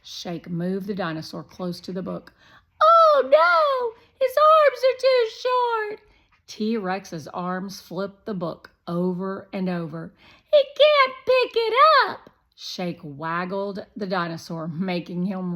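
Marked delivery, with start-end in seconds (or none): 2.8-6.0 very high, loud, whiny pretend dinosaur voice; squeaky toy dinosaur. Shake voicing the T-Rex toy. 10.5-12.3 very high, loud, whiny pretend dinosaur voice; squeaky toy dinosaur. Shake voicing the T-Rex toy.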